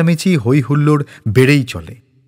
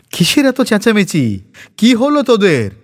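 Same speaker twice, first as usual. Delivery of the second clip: put-on alarmed old mother; 9 semitones higher than usual.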